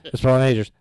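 excited, loud fan gasp; mock starstruck excitement. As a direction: blurt the name like a starstruck fan. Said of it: "behind him going".

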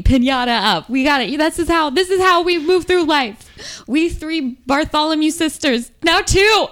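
high, loud, building declaration; triumphant, mounting cheer; rousing rally cry. Acted. Rousing toast to the sisters.

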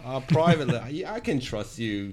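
put-on Australian accent. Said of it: "The accent is very good".

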